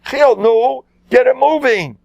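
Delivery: high, loud urgent prod; urgent call. Acted. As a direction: hurry the hidden man. Priests urging hidden accomplice.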